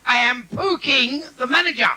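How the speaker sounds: high, slow put-on Chinese accent; mock Chinese accent. Comic voicing a Chinese restaurant manager, slow and high. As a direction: play the restaurant manager answering the phone.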